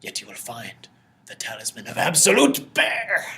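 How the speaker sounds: lightly voiced mysterious mage, building to a big finish; slow, portentous mage, relishing the last words; happy mage. Mage answers a bear-seeker, happy and portentous. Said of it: "he's, like, really happy about this".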